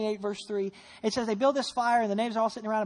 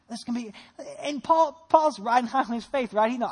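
normal talking voice

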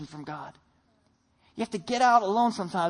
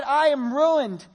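anguished cry; 4 semitones higher, 5 dB louder, much slower than usual. Acted. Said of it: "I cried".

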